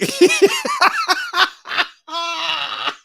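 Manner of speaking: high howling laugh, almost crying; big laugh. Real, not acted. Losing it while reacting to the story. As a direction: crack up hard at the story.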